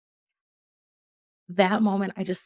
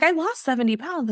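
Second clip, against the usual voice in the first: indignant protest; 8 semitones higher, 4 dB louder than usual. Retold or imitated. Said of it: "I, like".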